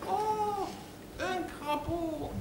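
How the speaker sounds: high, drawn-out squeal of wonder; gushing bimbo. Acted. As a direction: coo it like a delighted airhead. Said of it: "Et là, elle fait". (voix de bimbo émerveillée)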